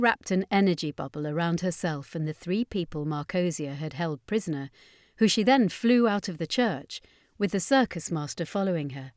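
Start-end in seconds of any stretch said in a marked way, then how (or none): none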